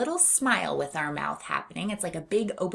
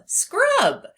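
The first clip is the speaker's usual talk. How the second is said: excited; 7 dB louder than usual. Shown as a demonstration. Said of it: "saying the word with gusto".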